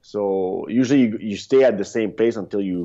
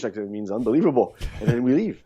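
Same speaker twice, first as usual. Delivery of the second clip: ordinary talk, nothing marked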